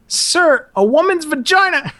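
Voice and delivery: high-pitched formal recruit announcement; cadet bracing himself. Retold or imitated. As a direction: imitate a kid forcing out a dreaded answer. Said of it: "took a deep breath".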